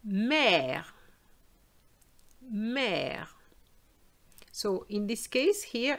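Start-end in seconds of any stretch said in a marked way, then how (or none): 0.1-3.3 mère drawn out on an open 'eh'; open French è. Shown as a demonstration. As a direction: open the mouth wide on the vowel of mère. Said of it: "you have to open your mouth".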